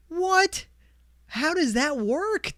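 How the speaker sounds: high, slowed "how does that work"; genuinely thrown by his own tears; baffled disbelief. Real, not acted.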